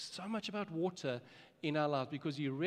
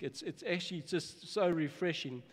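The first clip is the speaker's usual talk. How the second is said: normal talking voice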